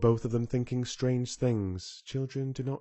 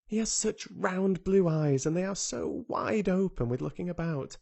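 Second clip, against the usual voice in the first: a hint of an earnest young girl, higher; lightly voiced girl Mary; 7 semitones higher than usual. A narrator's reading. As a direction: tell it like a wide-eyed child sharing a secret. Mary describes Dickon.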